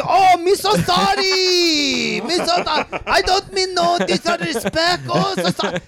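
very high, hammed-up mock Chinese accent. Shown as a demonstration. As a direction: wail apologies in a caricature Chinese accent. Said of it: "he just does a Chinese accent".

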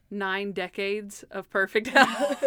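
slow, puzzled repeat breaking into laughter; slow dissecting. Retold or imitated. Host dissects Monk's joke for co-host, slow and amused. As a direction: spell it out slowly, then crack up. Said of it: "whenever you dissect it".

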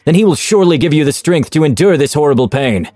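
a torturer exhorting his victim to pray; fervent zealot preaching. Acted. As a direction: preach the god's cruelty with rising zeal. Zealot preaching over screaming captive.

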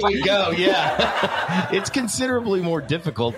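high, laughing through the words. Real, not acted. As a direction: laugh while you speak. Laughing after whistle attempt.

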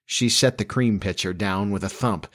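ordinary delivery, nothing marked

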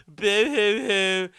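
slow mock boo-hoo sob; fake crying. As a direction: pretend to blub, hammed-up. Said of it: "makes me wanna cry".

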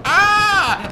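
sudden high yelp of alarm; high shout. Retold or imitated. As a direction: yelp in mid-fall. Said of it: "in the middle of saying".

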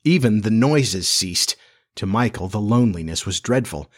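plain reading voice

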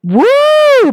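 high, loud whooping cheer; excited woo. Real, not acted. Vlogger cheering Becky walking out, a high excited woo. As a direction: whoop it out, thrilled.

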